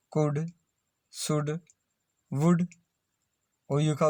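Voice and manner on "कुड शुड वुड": कुड, शुड, वुड with short "u", no L; silent L. Shown as a demonstration. Teacher reading model words to learners, कुड, शुड, वुड with silent L. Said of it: "एल silent है".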